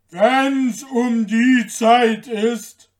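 loud, slow giant voice; big booming giant, stretched words. A narrator's reading. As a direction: boom it out slowly like a giant. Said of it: "sagte der Riese".

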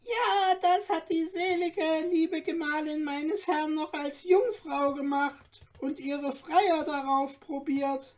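lively little dwarf. A narrator's reading. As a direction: chatter on like an excitable dwarf. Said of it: "rief er".

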